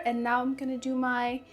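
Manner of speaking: ordinary talk, nothing marked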